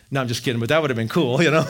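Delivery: usual speaking voice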